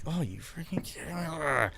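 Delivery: muttered aside. Retold or imitated. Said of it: "And I'm like".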